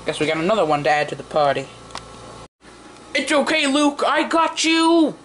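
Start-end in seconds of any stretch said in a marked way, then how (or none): 3.1-5.1 high-pitched rescuing character voice; toy hero to the rescue. Pretend. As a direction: call out high, stretching the last words.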